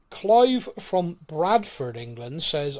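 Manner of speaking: announcer voice. Acted. A listener's question, announced grandly.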